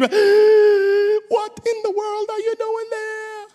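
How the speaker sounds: high, slow wailing cry; anguished cry. Pretend. Readers crying out at Samson's choice, high wail. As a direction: wail it in disbelief.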